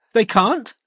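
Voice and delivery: high, desperate protest; desperate defiance. A narrator's reading. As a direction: defy him desperately. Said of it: "a kind of desperate defiance".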